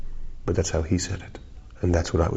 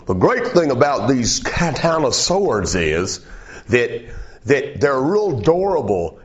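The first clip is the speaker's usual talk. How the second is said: higher, slow pitchman drawl; TV knife salesman; 7 semitones higher, slower than usual. Retold or imitated. Knife show host boasting about katanas, slow pitchman voice. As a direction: sell the sword like a TV host. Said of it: "he's going".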